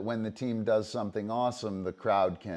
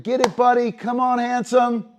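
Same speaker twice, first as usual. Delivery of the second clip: very high, loud, coaxing praise; encouragement; 13 semitones higher, 11 dB louder than usual. Shown as a demonstration. Handler encouraging the dog before the throw, very high and eager. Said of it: "give him a little encouragement".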